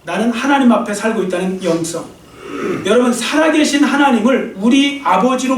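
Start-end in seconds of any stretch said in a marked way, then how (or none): none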